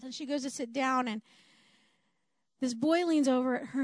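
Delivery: usual speaking voice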